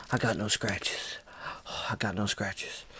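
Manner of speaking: acted panting between short phrases; breathing hard. Dreamer checking himself in the mirror, panting. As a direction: gasp out the words, reenacting shock. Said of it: "and I'm breathing hard".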